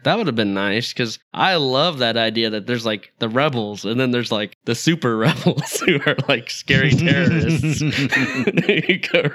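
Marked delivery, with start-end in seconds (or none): 5.2-9.3 laughing. Real, not acted. Joking about scary terrorist rebels.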